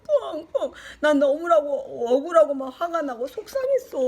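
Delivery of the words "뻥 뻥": high, hammed-up wail of pain; mock crying. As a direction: act out a child bawling from a hurt. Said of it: "나는 계속 울었어". (아파서 엉엉 우는 시늉)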